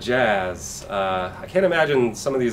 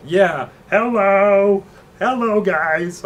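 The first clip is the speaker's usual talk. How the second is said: much higher, slow, drawn-out hello; 9 semitones higher, 6 dB louder, much slower than usual. Retold or imitated. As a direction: stretch each hello into a parody. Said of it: "He's like".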